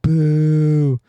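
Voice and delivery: long, loud boo; drawn-out loud Irish booing; mock jeer. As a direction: boo England like a heckler. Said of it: "if you're Irish, you're like".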